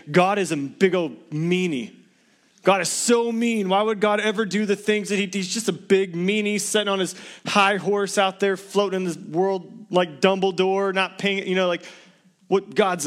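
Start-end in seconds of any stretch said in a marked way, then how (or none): 0.1-11.5 mock the people who call God mean; mocking a critic. Preacher mimicking critics of God, mocking. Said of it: "they'll think".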